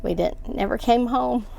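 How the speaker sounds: ordinary delivery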